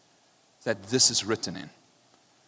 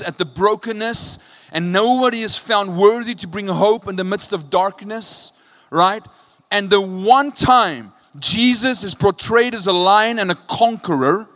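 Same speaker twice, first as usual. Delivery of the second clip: fervent preaching, higher and louder; preaching at full force; 7 semitones higher, 7 dB louder than usual. Acted. Preacher urging the congregation toward hope, fervent and loud. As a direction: drive it home with passion.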